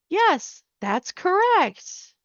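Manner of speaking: high, storybook-style praise, drawn out at the end; delighted confirmation. Acted. Scientist confirms the girl's answer, delighted storybook praise.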